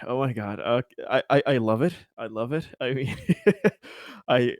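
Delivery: normal talking voice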